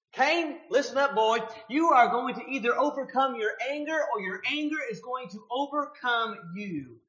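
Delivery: a stern father-like God warning Cain. Retold or imitated. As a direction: lay down the law to the boy.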